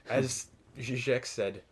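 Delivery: ordinary talk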